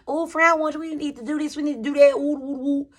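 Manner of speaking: high, eager, pleading friend; gushing friend. Retold or imitated. Friend trying to rope her into plans, high and gushing. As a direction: coax your friend into plans. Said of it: "She would tell me".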